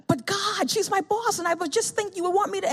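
high whining plea; high, pleading excuse to God. Retold or imitated. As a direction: beg in a high voice.

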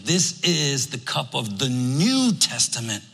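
slow, stress on "New" in "the New Testament"; emphasis on New. Shown as a demonstration. Pastor voicing Jesus at the table, New stressed. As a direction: draw it out and hit "New". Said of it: "And I'm putting emphasis".

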